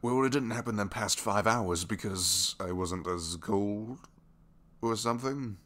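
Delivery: flustered excuse. Acted.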